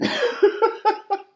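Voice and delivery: loud, high burst of laughter; real laugh. Real, not acted. Speaker laughing at his honest mirrors punchline, real laugh. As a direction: crack up at the punchline.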